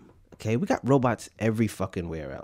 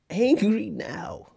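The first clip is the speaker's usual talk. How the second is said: mock angry; 9 semitones higher, much slower than usual.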